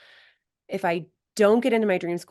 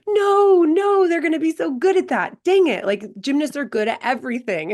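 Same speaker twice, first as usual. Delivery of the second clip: much higher and louder, playful wail of protest; dismayed protest; 10 semitones higher, 7 dB louder than usual. Retold or imitated. Coach reacting to a gymnast switching sports: loud, high playful dismay. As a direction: cry out like losing your favorite kid. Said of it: "We're like".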